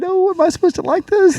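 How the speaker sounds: high whiny complaint; mock dismay. Dismay at new metal.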